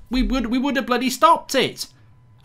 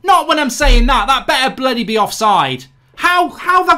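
real shout of disbelief, much louder; shocked outburst; 5 semitones higher, 10 dB louder than usual. Real, not acted. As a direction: yell it as the goal goes in. Opponents score during his watchalong.

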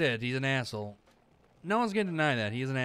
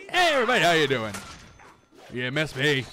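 mocking loud greeting; 2 semitones higher, 5 dB louder than usual. Real, not acted. Player taunting the enemy horde, loud mock greeting. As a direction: call out to the enemies with fake cheer. Taunting enemies as they arrive.

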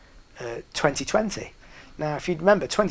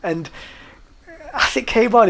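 ordinary talk, nothing marked

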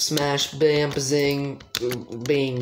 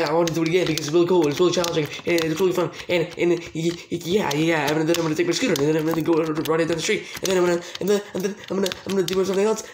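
breakneck run-on babble, slightly raised pitch; rapid-fire rambling; 3 semitones higher, much faster than usual. Pretend. Gamer trying to focus on winning, rapid-fire rambling. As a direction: gabble on without stopping, tripping over words.

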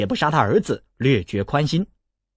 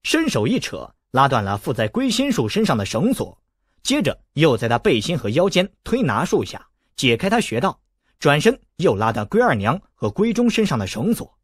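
ordinary delivery

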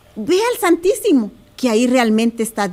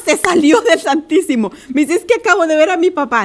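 laughing retelling; 2 semitones higher, 3 dB louder than usual. Real, not acted. Laughs retelling sister's quote.

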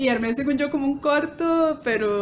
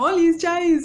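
higher, louder greeting; bright greeting; 5 semitones higher, 4 dB louder, much slower than usual. Retold or imitated. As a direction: call it out like a cheerful hello. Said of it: "Pero entonces era como".

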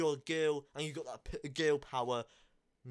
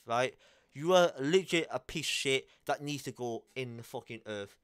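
ordinary talk, nothing marked

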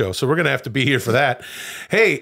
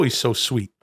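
usual speaking voice

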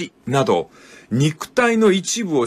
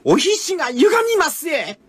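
much higher, Kyoto-dialect dance mistress voice; Kyoto dance teacher; 13 semitones higher than usual. Retold or imitated. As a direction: speak it like a Kyoto dance teacher gently correcting a maiko. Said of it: "舞を教えるお師匠さんの言葉で".

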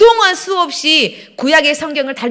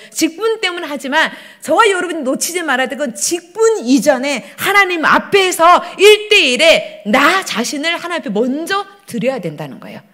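ordinary talk, nothing marked

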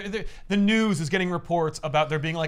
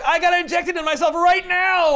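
frantic hurried Lizard; 13 semitones higher, 7 dB louder than usual. Retold or imitated. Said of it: "And was like".

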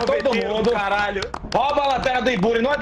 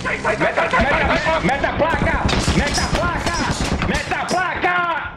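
urgent shouting; 6 semitones higher, slower than usual. Real, not acted. Urging teammate mid fight.

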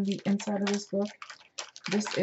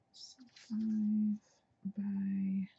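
whispering; 3 semitones higher, 3 dB quieter, much slower than usual. Real, not acted. Measuring, counting sizes quietly.

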